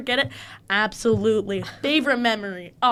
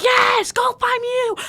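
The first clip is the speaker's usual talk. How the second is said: high, loud team yell; piercingly high hollered cheer; screamed cheer; 13 semitones higher, 5 dB louder, much slower than usual. Retold or imitated. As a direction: imitate screaming for the team from the crowd. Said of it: "we started screaming".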